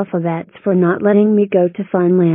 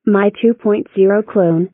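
usual speaking voice

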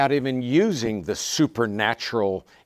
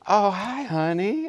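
put-on high, sugary greeting to a child; sudden sweet daddy voice; 11 semitones higher, 3 dB louder, slower than usual. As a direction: switch instantly to a sweet daddy tone. Husband switches voice for child.

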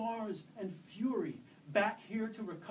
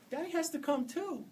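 put-on high, small child's plea; little girl pleading for her father, high and anxious; 11 semitones higher, 6 dB louder than usual. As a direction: beg like a scared kid.

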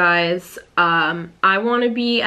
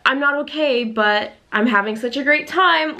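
higher, forced bright cheer; mock fake cheerfulness; 7 semitones higher than usual. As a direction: parody a fake-happy influencer post. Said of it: "for me to just be like".

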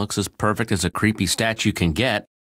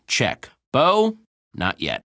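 normal talking voice

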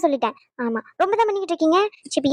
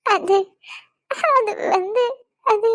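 halting, higher, tearful stammer; 6 semitones higher, much slower than usual. Acted. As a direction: struggle to get it out through tears. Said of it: "அழுதுகிட்டு இருக்கா".

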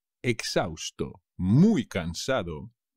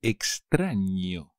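Extraño slow and clear; slow model word; slower than usual. Shown as a demonstration. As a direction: stretch Extraño slowly. (Extraño despacio)

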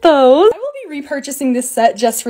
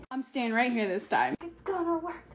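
tense near-whisper; 3 semitones lower, 14 dB quieter than usual. Real, not acted. Tense candy attempt.

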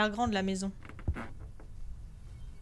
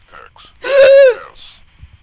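loud terrified gasp; 10 semitones higher, 19 dB louder than usual. Real, not acted. Jump scare in horror game.

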